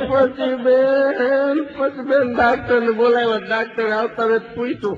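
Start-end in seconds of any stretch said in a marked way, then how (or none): 0.1-1.6 "પછી બેન" drawn out very slowly and higher; stretched out. Acted. Comedian telling the audience, dragging out "પછી બેન". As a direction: hold "બેન" long for suspense. Comic pause before the punchline.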